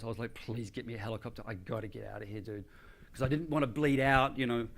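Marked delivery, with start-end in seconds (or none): 0.3-2.6 low, hushed begging; understated desperation, soft and lowered; desperate plea. Retold or imitated. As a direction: beg a friend quietly for rescue. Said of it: "This is how it was".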